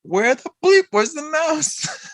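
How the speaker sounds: very high, slow mock outcry; squeaky exaggerated quote; hammed-up quote. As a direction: imitate a baffled demand, drawn out high. Quoting a favorite line, hammed up.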